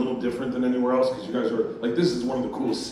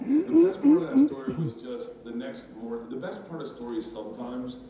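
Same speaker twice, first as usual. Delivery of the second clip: normal talking voice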